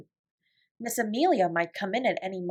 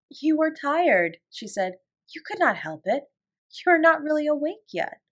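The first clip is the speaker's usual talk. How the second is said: friendly and kind; 7 semitones higher than usual. A narrator's reading. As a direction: comfort her kindly. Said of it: "such a nice, friendly sound".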